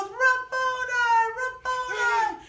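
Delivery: high, loud, drawn-out call; overjoyed cry. Acted. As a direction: cry out in joy, stretching it high. Mary recognizes her teacher.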